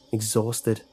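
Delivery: ordinary delivery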